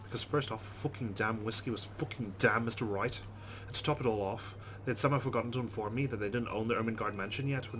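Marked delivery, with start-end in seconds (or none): none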